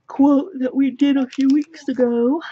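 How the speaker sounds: words stretched by a yawn. Real, not acted. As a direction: talk through a big yawn. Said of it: "Sorry for the yawn".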